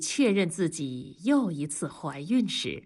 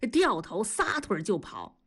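ordinary delivery

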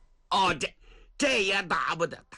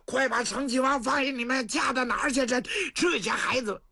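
shouting after them; 10 semitones higher than usual. Acted. Cheng Yaojin yells at the boys carrying the prince off, high alarmed shout. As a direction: bellow at the fleeing kids to stop. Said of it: "程咬金在后头直喊".

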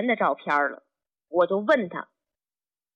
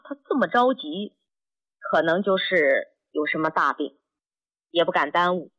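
ordinary delivery, nothing marked

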